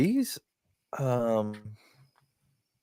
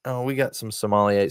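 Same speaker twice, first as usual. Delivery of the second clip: ordinary delivery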